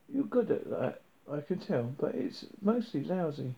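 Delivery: ordinary delivery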